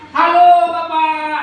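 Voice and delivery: loud, slow, overly casual hello. Shown as a demonstration. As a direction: call out like to a buddy. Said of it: "nggak boleh gitu ya".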